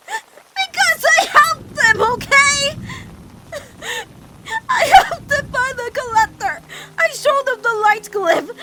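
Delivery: loud, high, tearful outburst; shouted admission, voice cracking with tears; anguished shout. Acted. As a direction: blurt the confession out in a cry. Forced confession under pressure.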